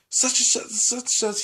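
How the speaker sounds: high-pitched stand-in for swearing; imitated chatter. Student mimicking a classmate's cussing, high-pitched imitation. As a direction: rattle it off up high, mimicking a kid.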